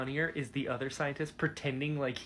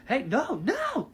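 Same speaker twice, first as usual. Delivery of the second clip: loud, high, drawn-out cry of objection; reenacted distressed yell, high and loud; audible protest; 11 semitones higher, 7 dB louder, slower than usual. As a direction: cry out in slow, acted-out alarm. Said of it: "I audibly was like".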